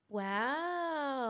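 long, soft, stretched wow; drawn-out wow. Shown as a demonstration. Singer shows off their habitual wow, drawn out and soft. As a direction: let the wow trail out slowly. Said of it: "I do the wow, but I do the".

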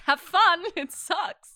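loud, high mock cheer, laughing; teasing send-off. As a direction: burst out teasing, through laughter.

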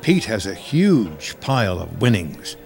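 ordinary talk, nothing marked

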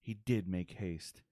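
slow, archaic storyteller voice; olde English speech. Retold or imitated. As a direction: speak like a medieval herald, slowly. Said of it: "talk in old English like".

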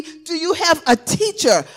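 usual speaking voice

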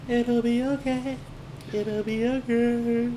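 high, slow soothing; high reassuring. Pretend.

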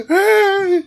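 mock gasping sob into a loud, high wail; bawling. Mother phoning her son after a crash, imitated bawling. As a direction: imitate someone bawling a greeting. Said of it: "she's bawling".

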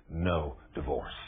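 preacher softly echoing the lawyer's story, quiet. Retold or imitated. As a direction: let it settle, hushed. Quiet echo of God's verdict.

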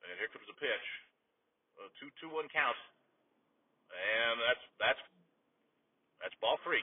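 low, soft radio pitch call; calm announcer voice calling a full count; hushed play-by-play. Acted. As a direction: drop down and call the count quietly. Calls the count.

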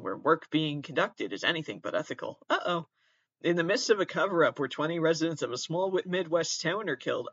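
ordinary talk

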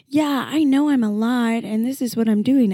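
brash, put-on confidence; playing someone totally secure; mock self-assurance. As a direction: act boldly unapologetic. Acting how people think she is.

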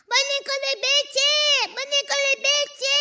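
high, sustained call. Acted. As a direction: call his name into the distance, pitched high. Calling for hidden grandfather. (strigare pe ton înalt)

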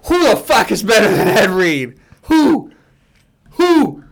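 loud, high heated demand; loud opinionated ribbing. Real, not acted. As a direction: shout the challenge at them. Heated debate over Ed Reed.

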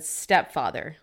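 ordinary talk

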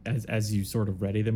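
usual speaking voice

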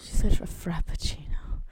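whispered aside, half to the audience; teasing whisper. Real, not acted.

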